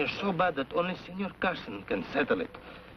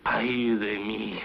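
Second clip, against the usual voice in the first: whispered lament; 5 semitones lower, much slower than usual. Acted. Mock lament sigh.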